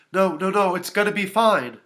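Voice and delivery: put-on urgent babysitter voice; urgent reassurance. A narrator's reading. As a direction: jump in to calm a crying child. Babysitter reassures crying child.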